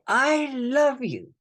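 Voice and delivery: tender slow affirmation; slow heartfelt mantra. Shown as a demonstration. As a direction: linger on love like a prayer.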